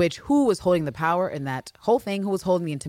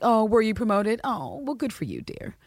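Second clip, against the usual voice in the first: condescending; 4 semitones higher than usual. Retold or imitated. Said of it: "condescending energy towards Villanelle".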